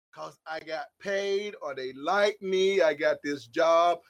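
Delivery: low, softened mock justification; understated put-on reasoning; mimicked excuse. Voices people's excuses for rejoicing.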